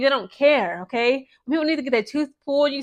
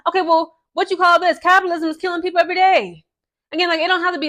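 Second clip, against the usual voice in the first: higher and louder, firing back; heated retort; 6 semitones higher, 3 dB louder, faster than usual. Real, not acted. Vlogger arguing with critics of universal healthcare, heated and raised. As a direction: push back hard, voice up. Angry rant against capitalism.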